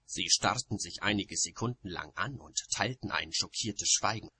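usual speaking voice

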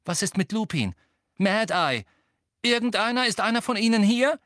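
rising, increasingly loud questions; urgent questioning. A narrator's reading. Harry anxiously demands who is there.

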